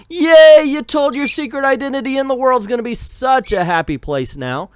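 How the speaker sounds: high, loud, gushing mock cheer; mocking cheerful voice. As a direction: squeal it like a naive fan. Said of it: "every character in this book is like".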